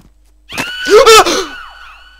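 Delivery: loud high shrieking gasp; terrified scream-gasp. Real, not acted. Player jumpscared again, screaming gasp.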